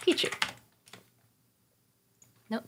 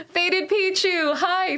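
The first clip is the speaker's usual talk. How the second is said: higher, slow sing-song name call; drawn-out greeting; 9 semitones higher, much slower than usual. Real, not acted. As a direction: stretch the name out warmly. Greeting a chatter.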